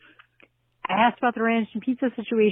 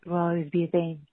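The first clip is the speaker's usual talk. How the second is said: usual speaking voice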